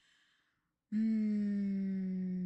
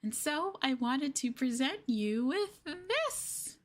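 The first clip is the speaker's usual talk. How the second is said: ordinary talk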